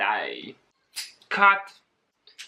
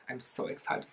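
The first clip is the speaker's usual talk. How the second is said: whispered excitement; 4 semitones lower, 14 dB quieter, faster than usual. Real, not acted.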